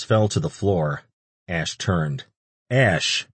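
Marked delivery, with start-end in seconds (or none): 2.7-3.3 mild call to someone upstairs. A narrator's reading. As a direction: call up to get his attention. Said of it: "Antonio's voice called from underneath him".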